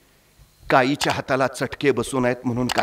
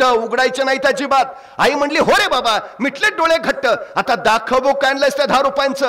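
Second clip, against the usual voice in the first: emotive Marathi storytelling, rising with the drama; story narration; 8 semitones higher than usual. A narrator's reading. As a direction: tell it like a village storyteller moving the crowd. Storyteller narrating village tale.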